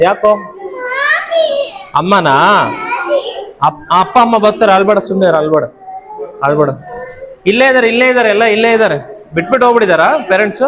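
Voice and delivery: calm the little one down, parents are near. Real, not acted. Comforting crying kids on first day.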